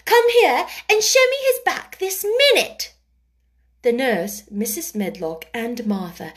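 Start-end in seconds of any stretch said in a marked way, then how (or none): 0.1-2.9 put-on high, loud imperious child; commanding. A narrator's reading. Mary orders the nurse to show his back, commanding. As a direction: order her over at once. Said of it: "she commanded".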